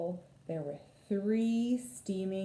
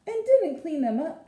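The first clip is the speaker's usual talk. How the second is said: louder, higher huffy add-on; indignant addition; 9 semitones higher, 7 dB louder than usual. A narrator's reading. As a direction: tack on the complaint sharply. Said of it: "added Missus Chan".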